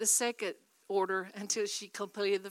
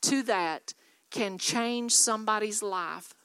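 louder, ringing preacher's call to the church; preaching at full force; 6 dB louder than usual. Acted. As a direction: drive each line home to the congregation. Preacher exhorting the congregation.

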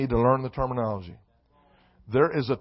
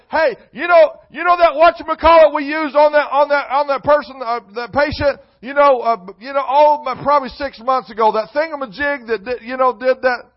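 high, loud rambling mock voice; hammed-up clueless doctor; bumbling doctor; 10 semitones higher, 9 dB louder than usual. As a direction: fumble the words like a doctor who never learned the jargon. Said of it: "a doctor can't say".

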